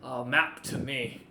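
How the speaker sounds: loud, slow boasting; Crump, pleased with himself, bragging to the party; proud NPC boast. Acted. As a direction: brag it with a thumbs up. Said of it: "gives a thumbs up and goes".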